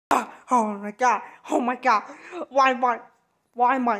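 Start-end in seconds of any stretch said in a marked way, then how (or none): none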